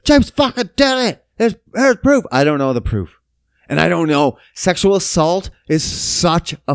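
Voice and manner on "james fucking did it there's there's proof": high, louder indignant accusers; high insistent mock outrage; mock outraged public. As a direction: insist like outraged internet crowd. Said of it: "they're like".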